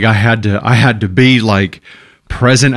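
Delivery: ordinary talk, nothing marked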